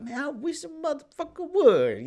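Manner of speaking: high-pitched, hammed-up swagger; playful squealed bravado; mock cocky boast. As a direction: crow it way up high, daring all comers. Acting cocky after winning at sparring.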